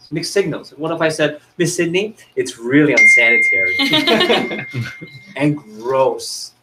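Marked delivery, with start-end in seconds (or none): 1.6-6.5 gleeful sales pitch breaking into laughter; mismatched cheer. Shown as a demonstration. Presenter showing mixed signals to a customer, laughing. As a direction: say bad news brightly, laughing through it.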